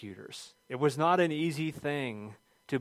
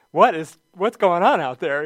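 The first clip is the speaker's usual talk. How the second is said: louder, higher mock-alarmed question; imitating alarmed wife; 4 semitones higher, 11 dB louder than usual. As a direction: play the startled wife demanding answers. Wife's alarmed question, acted out.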